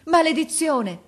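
very high, loud cry of dismay; shouted curse. A narrator's reading. Officer sees boy fall, shouted curse. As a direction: burst out as you rush over. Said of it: "gridò l'ufficiale accorrendo".

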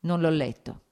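usual speaking voice

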